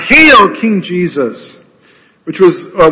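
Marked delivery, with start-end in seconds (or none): 0.1-1.6 mocking cry. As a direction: hail him like a soldier making fun of a fake king. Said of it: "mockingly they bow before him and cry out".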